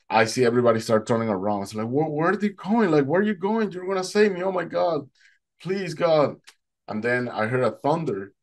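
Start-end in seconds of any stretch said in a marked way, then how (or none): 1.9-6.4 high, desperate pleading; retold panicked plea. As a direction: plead as if being left behind. Abandoned swimmer pleads for rescue.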